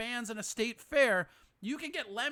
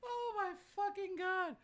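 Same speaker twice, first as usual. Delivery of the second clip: laughing disbelief; 11 semitones higher, 6 dB quieter than usual. Real, not acted. Laughing at the clip.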